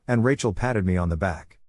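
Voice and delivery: usual speaking voice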